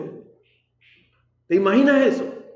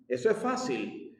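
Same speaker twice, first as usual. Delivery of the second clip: much softer, easy reassurance; soft reply; 5 semitones lower, 12 dB quieter than usual. Retold or imitated. God answering the failed believer in a soft, easy voice. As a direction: say it as if it were no big deal.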